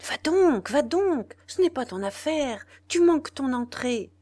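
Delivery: loud, high urging; urgent push. A narrator's reading. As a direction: shove her on with your voice. Pushing Rose on stage. (le directeur presse Rose d'entrer en scène, fort et pressant)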